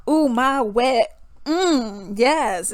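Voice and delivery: slow, drawn-out mock moan; mock sultry rapper. Imitating raunchy rap lyrics, slow mock-sultry. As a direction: lightly parody a raunchy lyric, stretching the words.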